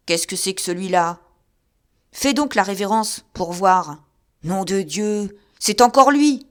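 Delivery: loud, jeering abuse at an imagined wife; delirious drunk insulting his wife; drunken raving. Acted. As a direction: hurl taunts at a vision.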